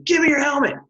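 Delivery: a retold order snapped out at a player; coach's command.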